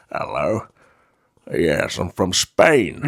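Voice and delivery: low, slow Connery drawl posing as Spanish; lightly mock Sean Connery, slow and low; Sean Connery impression. Podcast host mocks Connery's Spaniard in a low, slow drawl. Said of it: "he shows up like".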